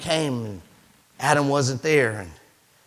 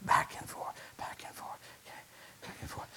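whispered fade; 16 dB quieter than usual. Shown as a demonstration. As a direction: back and forth fading to a bare whisper. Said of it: "fading repeats".

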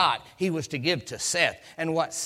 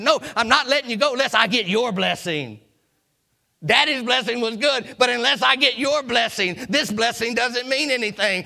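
loud, higher, driving sermon voice; fervent preaching; 6 semitones higher, 6 dB louder than usual. Real, not acted. Preacher pressing the congregation hard on God's blessing. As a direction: preach it at full force.

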